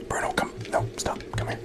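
ordinary delivery

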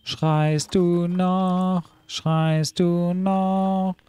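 very slow, stretched sing-song; slow cooing. Pretend. Player teasing the shouting seal, very slow. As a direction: coo at the seals slowly.

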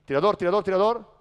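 loud court call. Shown as a demonstration. Coach showing the shooter call to José, loud. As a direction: warn your teammate of the shooter. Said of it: "dígale".

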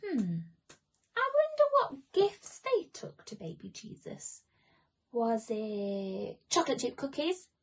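ordinary delivery

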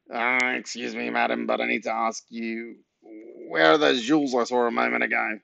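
awkward nasal character voice; nasal nosy neighbour. A narrator's reading. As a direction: question her officiously through the nose. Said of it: "had a very nasal voice".

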